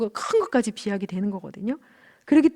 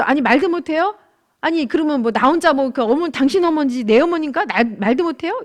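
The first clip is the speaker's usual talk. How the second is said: higher, louder indignant protest; angry retort; 4 semitones higher, 5 dB louder than usual. Retold or imitated. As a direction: retell a wife arguing back at her husband. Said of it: "제가 이제 화를 낸 거죠". (화나서 따지며 대드는 말투 재연)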